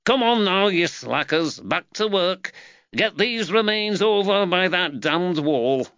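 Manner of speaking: high-pitched foreman calling to his crew; barking orders. A narrator's reading. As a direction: chivvy slacking workmen along, pitched well up. Foreman orders his workmen back to work.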